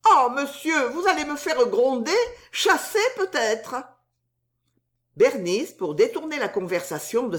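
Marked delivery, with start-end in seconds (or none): 0.1-3.8 voix aiguë et plaintive de jeune servante; gémir un peu, inquiète d'être grondée; soubrette inquiète. A narrator's reading. Susan inquiète. (Susan craint d'être chassée, voix aiguë plaintive)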